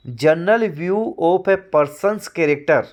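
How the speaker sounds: slow, deliberate reading of a definition; slow dictation. Shown as a demonstration. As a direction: read it slowly so students can write it down. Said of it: "dictates definition".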